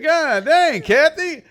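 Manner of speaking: high, loud exasperated outburst; mock outrage. Son reacting to his mom's critique, mock outrage.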